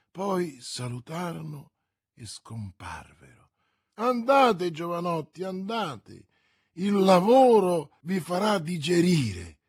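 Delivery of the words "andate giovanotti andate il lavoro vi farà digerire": solemn procurator, louder, weighty send-off; a hint of a stern master; grave. A narrator's reading. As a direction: dismiss the clerks with mock gravity. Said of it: "disse con gravità il procuratore".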